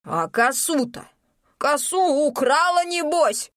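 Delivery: put-on high, slow old man's cry; shaky old man accusing. Old villager accuses with trembling hand.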